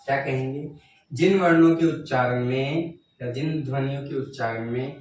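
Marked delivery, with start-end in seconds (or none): none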